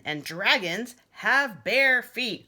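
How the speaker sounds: put-on boy insisting dragons go barefoot; proud dragon boast. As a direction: stretch each word like a proud little dragon.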